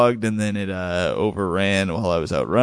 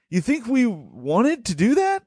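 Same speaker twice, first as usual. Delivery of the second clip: raised, slow, incredulous retort; mock incredulous; 11 semitones higher than usual. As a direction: throw it back at him in disbelief. Mocking reply to angry neighbor.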